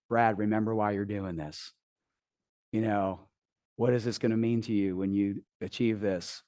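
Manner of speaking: low, soft, murmured encouragement close to the ear; coach whispering. Retold or imitated. Coach kneeling by the ice bath, soft whispered encouragement. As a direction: soothe him quietly through the panic. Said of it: "she starts whispering in my ear".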